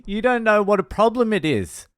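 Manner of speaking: high-pitched mock complaint; retold yelling. Drunk girl going off her head at comedian, mock yelling. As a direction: imitate a drunk girl's indignant rant. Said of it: "is yelling at him".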